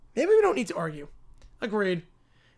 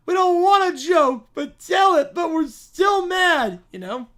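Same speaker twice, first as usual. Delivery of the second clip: put-on bratty child; 4 semitones higher, 4 dB louder than usual. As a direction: whine like a spoiled child. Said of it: "the Brat Pack".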